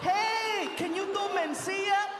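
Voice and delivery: high-pitched eager impression; Paul Rodriguez. Fellow comic requests another impression, high celebrity voice. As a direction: call it out in a put-on comic's voice. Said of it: "I did that to Paul Rodriguez".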